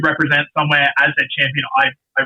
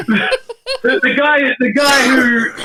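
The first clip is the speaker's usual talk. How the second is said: laughing through the words, voice pitched up; nervous laugh breaking into the sentence; 9 semitones higher, slower than usual. Real, not acted. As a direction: giggle out the guy who. Laughs at his own nerves.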